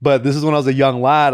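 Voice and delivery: ordinary talk, nothing marked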